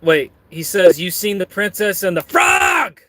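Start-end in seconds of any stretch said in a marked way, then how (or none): 1.0-3.0 high voice bursting into a yell on frog; mock shout for capital letters; screamed last word. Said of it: "You gotta scream it".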